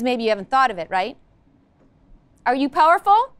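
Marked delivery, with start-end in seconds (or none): 2.4-3.3 a louder, eager call to the class; rising rally question. Real, not acted. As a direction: pump them up, lift at the end.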